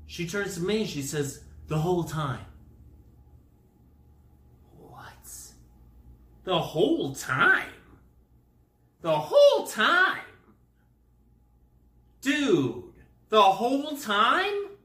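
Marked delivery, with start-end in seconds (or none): 4.8-5.3 tiny whispered disbelief; acted, breath-only reaction; stunned whisper. 6.4-7.7 slow, loud mock disbelief; stunned, drawn-out question; incredulous. Acting out disbelief. 9.0-14.7 high, loud incredulous question; hammed-up shocked repeating; outraged disbelief. Acting out growing disbelief.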